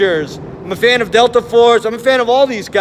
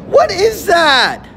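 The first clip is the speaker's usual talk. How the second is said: high, slow, drawn-out disbelief; incredulous cry; 6 semitones higher, much slower than usual. Real, not acted. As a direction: stretch it out in disbelief. Incredulous outburst at the footage.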